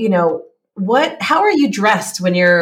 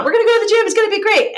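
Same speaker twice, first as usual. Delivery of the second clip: much higher, excited self-promise; eager pep talk; 13 semitones higher, much faster than usual. Acted. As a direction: psych yourself up in a high, eager voice.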